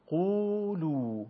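قولو with both u vowels held long, high; long Arabic u. Shown as a demonstration. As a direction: stretch قولو slowly, quuluu. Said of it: "واو مدی به صورت". (قولو با واو مدی کشیده)

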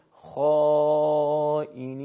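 خائنین with a thick خ and long dark ā, chanted loudly; slow, loud recitation of خائنین with rounded alef; full, heavy khā. Shown as a demonstration. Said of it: "حرف خا رو با الف مدی".

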